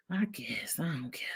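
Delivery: very soft, half-whispered shrug; muttered aside. Real, not acted. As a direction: drop it under your breath, dismissively. Dismissive aside under the breath.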